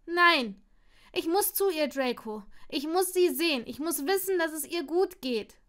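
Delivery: high, loud, stressed insistence; desperate pleading. Acted. Neville pleading with Draco to see his grandmother, loud and urgent. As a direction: beg him to understand.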